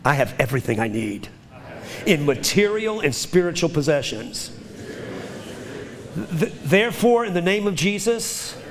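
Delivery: rhythmic call-and-response declaration, rising to a fervent build; leading a confession. Acted. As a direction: proclaim each line for the church to repeat. Leads congregation in spoken confession.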